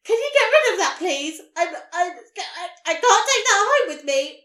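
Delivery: loud, high frantic please get rid of that, with laughter; uncalm pleading. Retold or imitated. To the nurse about the skin in her leggings, loud frantic pleading. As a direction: beg in a panic, half laughing. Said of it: "very uncalmly said to her".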